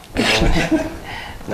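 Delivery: high, airy laugh at his own joke. Real, not acted. Guest laughs at his own astronaut joke, high laugh.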